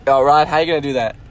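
ordinary delivery

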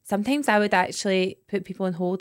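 ordinary talk, nothing marked

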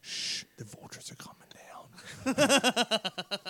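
slow hushed whisper. Acted. Rooster whispering to the farmer, slow hushed whisper. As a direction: whisper it slowly, playing dead. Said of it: "And he goes".

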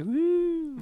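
high, drawn-out "woo"; a long lilting woo of a skipping kid; carefree woo. Retold or imitated. Child skipping along behind the mower, carefree high woo.

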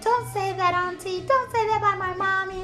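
high-pitched imitation of a pleading child; little girl. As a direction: mimic a small girl protesting to her auntie. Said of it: "So the little girl's like".

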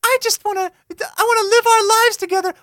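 weep over the tragic love story; a hammed-up, tearful falsetto; melodramatic crying. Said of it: "dramatic reading".

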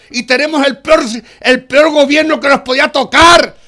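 heated shouting tirade; furious rant. Real, not acted. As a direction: rage at the government until told to calm down. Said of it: "Vale, cálmate".

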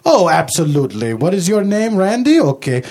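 a hint of a Dracula accent; bad vampire-ish accent. Fan greets Mister Guerrero, light put-on accent. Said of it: "what's up with your accent".

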